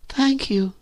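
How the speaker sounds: put-on high, girlish thanks; meek thanks. A narrator's reading. Virginia thanks the narrator, meek. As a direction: say it small and high-pitched.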